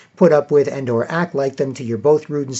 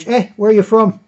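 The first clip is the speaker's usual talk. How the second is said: high, louder questioning call; curious; 8 semitones higher, 6 dB louder than usual. Retold or imitated. Bar locals to a visiting stranger, curious. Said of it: "they're kinda curious".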